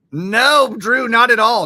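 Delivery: real flustered protest; emphatic denial. Real, not acted. Denying a misread comment.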